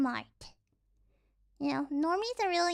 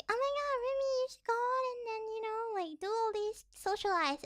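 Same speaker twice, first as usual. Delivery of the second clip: nagging relative; 5 semitones higher, 5 dB quieter, faster than usual. Retold or imitated. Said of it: "talk about, like".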